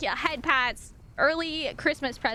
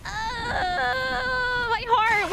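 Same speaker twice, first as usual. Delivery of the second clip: touched, near tears; 7 semitones higher, much slower than usual. Real, not acted. Streamer moved by an anonymous gift, tearful wail. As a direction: wail it, heart melting. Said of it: "trying to make me cry".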